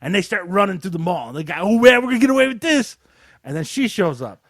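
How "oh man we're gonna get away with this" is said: high, loud mock gloating; cheesy movie crook. Robbers running through the mall, hammed-up gloating crook. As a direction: imitate a corny robber on the run. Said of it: "This is how they're talking".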